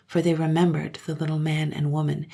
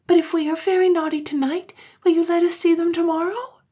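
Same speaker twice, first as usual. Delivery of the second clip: put-on high, eager voice of small children; higher child voice, cried out; children's voice; 13 semitones higher, 6 dB louder than usual. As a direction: play the pleading little ones. Children's voice held.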